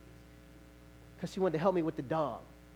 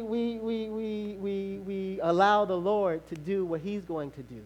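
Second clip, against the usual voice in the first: ordinary delivery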